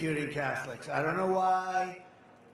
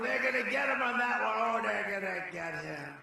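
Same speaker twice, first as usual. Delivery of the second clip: mock opponents; 3 semitones higher than usual. Mimicking opponents plotting against him.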